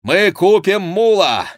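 high, loud, drawn-out call; called-out reply. A narrator's reading. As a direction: call it back over the gallop. Chicot calls back while galloping. (громкий протяжный ответ на скаку)

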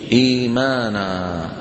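إيمانا chanted slowly with a long stretched إي; drawn-out recitation. Shown as a demonstration. Teacher showing students how إيمانا is recited, slow and chanted. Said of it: "شو بتصير؟".

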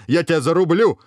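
high, loud enraged threat; furious shout. A narrator's reading. Hero losing his temper at the dragon, furious shout. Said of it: "крикнул, выходя из себя герой".

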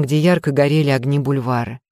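ordinary delivery

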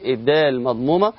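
normal talking voice

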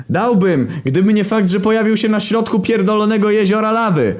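high, exasperated yell; shouting back. Acted. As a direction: holler back across the distance. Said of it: "odkrzyknąłem".

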